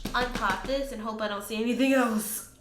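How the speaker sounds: ordinary talk, nothing marked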